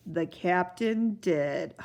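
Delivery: plain reading voice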